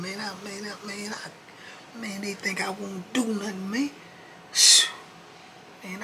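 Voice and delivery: high put-on voice, grumbling and sulky; Keith ranting. Keith sulking in the cell after being teased, high and grumbling. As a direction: mutter threats you won't carry out.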